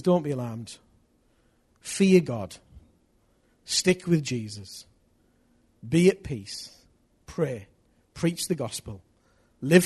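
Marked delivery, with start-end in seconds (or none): 0.0-6.7 lower, softer "don't be alarmed"; calm the worried listeners; gentle reassurance. Acted. Preacher soothing congregation, low and soft. Repeating Jesus' advice softly.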